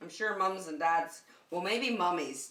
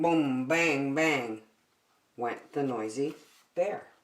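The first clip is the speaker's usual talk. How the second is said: loud, lively storybook reading; reading to children; 4 semitones lower, 5 dB louder than usual. Acted. Teacher reading a picture book to children, loud and animated. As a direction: read it out big for a room of kids.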